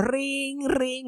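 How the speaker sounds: high sing-song ring ring; phone ring imitation. Phone ringing after the emergency button, high imitation. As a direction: mimic the cabin phone ringing.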